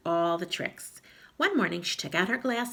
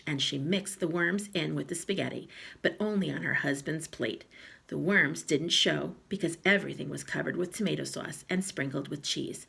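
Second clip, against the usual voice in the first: ordinary delivery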